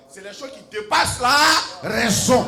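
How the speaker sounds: loud, rising preacher's cry over the music; sermon shouted with fire; full-force preaching. Acted. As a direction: proclaim it to the whole hall.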